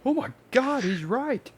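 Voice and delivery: high, amazed gasp; suddenly convinced and awed; awestruck high voice. Pretend. Converted crowd member voiced.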